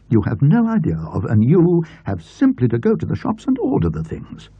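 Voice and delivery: a put-upon aunt scolding her nephew. A narrator's reading.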